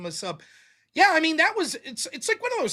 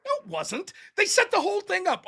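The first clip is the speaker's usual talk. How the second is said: indignant; 8 semitones higher than usual. Real, not acted. Indignant rebuttal.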